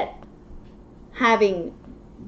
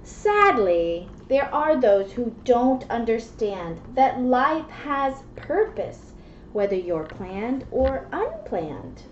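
put-on reading to children; 5 dB quieter than usual. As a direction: read the rhymes gently to a child. Reads picture book aloud.

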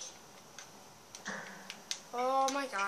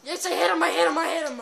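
loud, higher whoops of triumph; excited cheering; 5 semitones higher, 10 dB louder, faster than usual. Real, not acted. Player landing hits on an opponent, cheering loudly. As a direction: celebrate the hit out loud.

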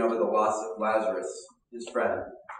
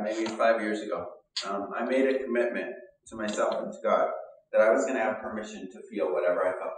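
normal talking voice

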